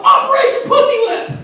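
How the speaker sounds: high-pitched mock code announcement; mock battle cry. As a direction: call it out high, like a silly mission. Imagined teenager calls out code.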